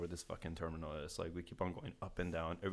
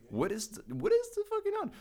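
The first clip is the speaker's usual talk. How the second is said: much higher and louder, incredulous; excited disbelief; 6 dB louder than usual. Real, not acted. Host can't believe the odds, asks in excited disbelief. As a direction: squeal the question out.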